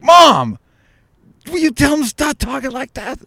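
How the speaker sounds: put-on high, loud pleading kid call to Mom; boy begging his mother to stop the teasing; whiny kid. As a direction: complain to Mom like an embarrassed boy. Kid complaining to mom about stepfather.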